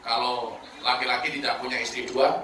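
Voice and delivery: mildly pitiful husband, low and soft; understated plea to the wife; pleading husband. Acted. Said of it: "Suaminya memelas".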